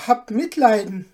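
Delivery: a small cook begging on his knees; frightened plea; pleading dwarf. Acted. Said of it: "rief der Kleine".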